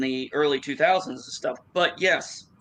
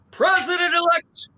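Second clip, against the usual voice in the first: very high, loud headline cry; high, raised town-crier style announcement; headline yell; 4 dB louder than usual. Acted. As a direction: blare it out like an all-caps headline. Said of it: "That's how the print comes out to me".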